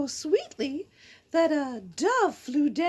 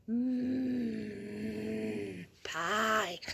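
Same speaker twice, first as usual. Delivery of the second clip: much lower, much softer, dreamy; dreamy pie craving; 9 semitones lower, 8 dB quieter than usual. Real, not acted. Kid daydreaming about pie, low dreamy murmur. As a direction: murmur it, lost in the thought of pie.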